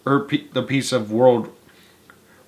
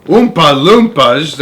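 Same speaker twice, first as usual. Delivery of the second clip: slow, loud group shout; 4 semitones higher, 7 dB louder, slower than usual. Acted. Everyone shouting the name at once in amazement, loud and slow. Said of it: "everyone shouted at once".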